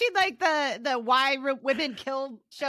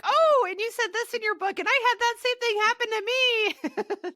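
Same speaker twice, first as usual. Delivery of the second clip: excited fangirl gush; 9 semitones higher, faster than usual. Retold or imitated. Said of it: "I was all like".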